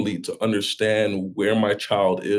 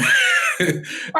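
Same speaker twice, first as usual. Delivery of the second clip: high laugh bursting out; burst of laughter. Real, not acted. Father laughing at his son's question, high laughter.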